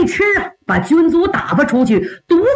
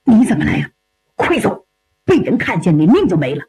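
mildly alarmed, urgent warning; a storyteller's understated fearful urging; frightened; 5 semitones lower, faster than usual. A narrator's reading. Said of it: "赵匡胤一看害怕了". (略带惊慌地催人快走)

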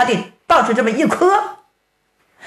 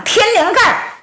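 shouting while fighting; 8 semitones higher than usual. Acted. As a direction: bark out each target as you strike. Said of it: "一边打一边喊".

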